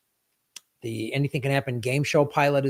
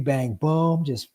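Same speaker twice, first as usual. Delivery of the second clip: ordinary talk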